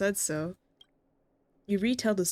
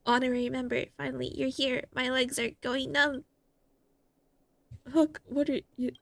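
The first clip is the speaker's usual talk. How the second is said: put-on child voice; 5 semitones higher than usual. Voicing the child Hook.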